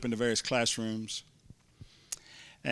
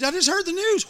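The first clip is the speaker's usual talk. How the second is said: much higher and louder, breathless joy; excited news; 6 dB louder than usual. Retold or imitated. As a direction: call her name bursting with news. Repeats even more excited.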